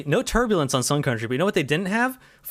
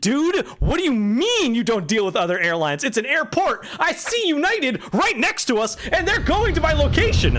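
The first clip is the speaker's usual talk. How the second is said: exasperated rant; 9 semitones higher than usual. Retold or imitated. Arguing with airline staff.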